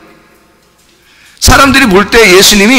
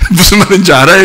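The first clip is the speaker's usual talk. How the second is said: ordinary talk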